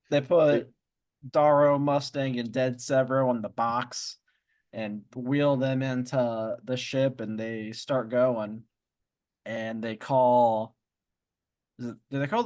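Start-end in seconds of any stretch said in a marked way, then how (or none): none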